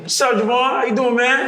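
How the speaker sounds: high, loud, loose impression of a laid-back director; casual greeting, pitched well up; relaxed director. Director greets actor on the call while eating cereal, put-on relaxed voice. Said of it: "he's so relaxed".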